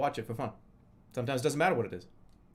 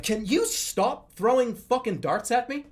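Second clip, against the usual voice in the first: exasperated plea; 8 semitones higher, 4 dB louder, slower than usual. Retold or imitated. Fed-up victim of darts.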